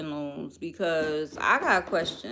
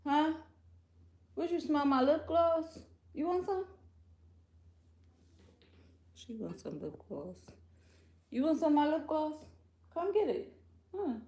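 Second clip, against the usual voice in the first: much higher, sweet teasing pet voice; 8 semitones higher, 5 dB quieter than usual. Real, not acted. Offering her lip gloss to Tootie, in high teasing baby talk.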